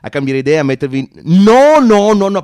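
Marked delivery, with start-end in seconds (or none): 1.4-2.4 louder, quick denial; mock-defensive no no; hasty loud protest. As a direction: cut off the objection loudly. Salesman protesting hastily.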